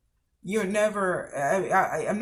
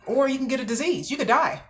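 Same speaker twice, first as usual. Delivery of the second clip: higher and louder, pressing the danger; emphatic warning; 6 semitones higher, 3 dB louder than usual. Real, not acted. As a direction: raise the voice to warn them. Warning about risks of sex.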